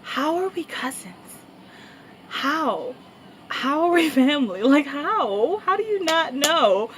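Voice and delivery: high, incredulous mock questioning; disbelief. Asking her cousin how she missed him, incredulous. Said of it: "And I look at her like".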